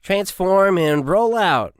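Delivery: loud, high, slow mock-heroic line; joking cartoon catchphrase. Private cracks a joke as the squad mounts up, hammed-up catchphrase. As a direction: ham up a cartoon robot leader's call. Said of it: "joked Collins".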